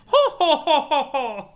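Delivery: big high ho ho ho laugh; mock jolly ho ho laugh. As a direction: belt out a jolly ho-ho-ho. Laughing sprite.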